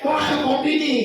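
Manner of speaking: "Mak, mana kopiku?" called out like a lazy husband; mock demanding husband. Husband demands coffee, acted. (suami manja meneriaki istri minta kopi)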